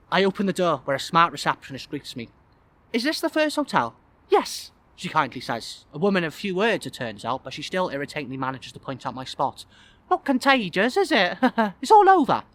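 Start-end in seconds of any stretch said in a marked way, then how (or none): 2.9-4.7 high-pitched receptionist character. Acted. Receptionist greeting a late wedding guest, high character voice. 10.1-12.4 high, loud character voice with a laugh; receptionist voice. Acted. Receptionist pointing out a guest's spot, high teasing voice.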